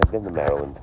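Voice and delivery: usual speaking voice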